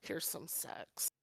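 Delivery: slow, very soft whisper; hushed, drawn-out punchline; mock sly whisper. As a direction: whisper it slowly like a naughty offer. Acted book offering a naughty surprise.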